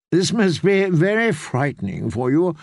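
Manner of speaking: ordinary delivery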